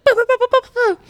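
very high, loud sing-song boo boo; rushing noise. Retold or imitated. Doctor hurrying between rooms, very high silly boo boo noise. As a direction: zip along making a silly noise.

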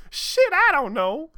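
high, loud, slow squealed outburst; worked up. Acted. Amy squealing her answer to Sonic, worked up. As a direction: stretch it out in a flustered squeal. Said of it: "still all worked up".

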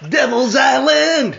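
high, loud, drawn-out exclamation; excited title shout. Real, not acted. Host telling co-host why the book was picked, excited shout. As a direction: belt out the title with glee.

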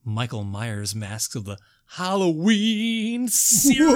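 loud show-host return, stretching the title; hammed-up announcer. Host welcoming listeners back from the break, hammed-up announcer.

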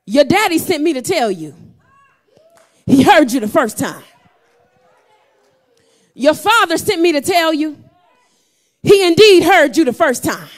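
loud, high preacher's shout over music; preacher whooping to the church over the organ; full-force preaching. Acted. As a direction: build the crescendo, push every line out.

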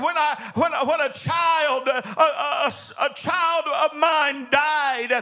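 angry prayer. Acted. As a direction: hurl the questions at God. Impassioned lament, shouting at God.

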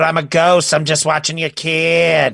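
high, loud playful ghost. Pretend. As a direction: play an innocent ghost nanny. Voicing the ghost nanny.

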